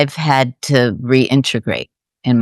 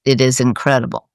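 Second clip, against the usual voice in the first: ordinary talk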